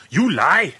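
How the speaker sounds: very high, loud scream; furious scream. A narrator's reading. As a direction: scream the accusation in rage. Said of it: "and screams".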